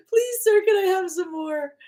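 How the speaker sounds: high, whimpering mock plea; hammed-up orphan begging; Oliver Twist plea. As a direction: beg like a hungry orphan, laughing.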